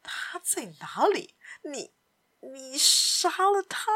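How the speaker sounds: whispery start rising to a high, tense question; hushed question. A narrator's reading. Gloomy captain asking whether she was killed, hushed then rising. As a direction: ask under your breath, then let it climb. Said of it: "小声地问".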